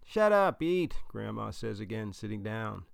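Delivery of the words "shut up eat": put-on high, loud Italian-accented grandmother; Italian grandma's raised-voice order; thick Italian accent. A narrator's reading. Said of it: "in her thick accent".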